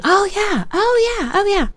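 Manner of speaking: nodding along eagerly while reading; excited agreement. Retold or imitated. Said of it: "reading it going".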